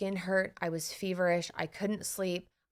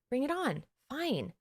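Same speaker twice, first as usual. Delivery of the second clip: defiant; 6 semitones higher, slower than usual. Retold or imitated.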